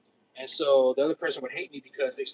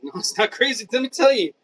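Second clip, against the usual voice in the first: building higher and louder with a laugh; from a laugh to an emphatic, loud So; laughing insistence; 10 semitones higher, 12 dB louder than usual. Real, not acted. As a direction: push back, getting more worked up. Insists to imagined skeptic.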